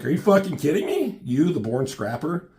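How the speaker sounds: high, loud mock disbelief; incredulous. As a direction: scoff in disbelief at the scrapper. Teammate mocks Wolverine's change.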